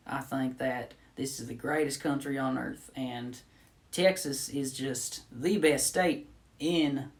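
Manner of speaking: friendly Texan introducing himself; Texan accent. Shown as a demonstration.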